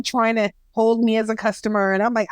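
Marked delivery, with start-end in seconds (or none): none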